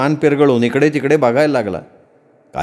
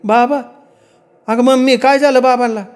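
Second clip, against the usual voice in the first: put-on girl crying out; 10 semitones higher than usual. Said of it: "मोठ्यानं ओरडली".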